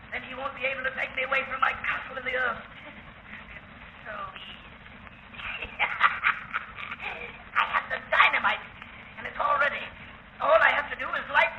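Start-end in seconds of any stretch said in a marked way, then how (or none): 4.1-5.7 very slow, much lower and softer; a madman quietly savouring his plan; gloating murmur. Acted. Madman muses on killing all. 5.7-7.6 soft unvoiced giggle to himself; a madman chuckling quietly over his plan; breathy mad chuckle.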